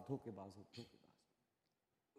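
धोखेबाज हो trailing off into a slow whisper; fading to a whisper. Shown as a demonstration. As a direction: let धोखेबाज हो die away into a whisper. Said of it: "बस दो चार लाइनें बोल दो".

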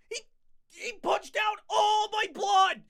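high, slow hammed-up wail; mock wailing. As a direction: wail over the lost blood.

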